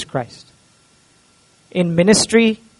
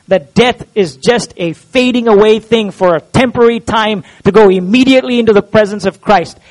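ordinary talk

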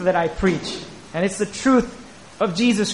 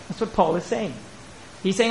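ordinary talk, nothing marked

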